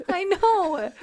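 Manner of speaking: laughing through the words; a real laugh spilling into it; giggling agreement. Real, not acted. Laughing after tearful exchange.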